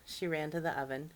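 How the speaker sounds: ordinary delivery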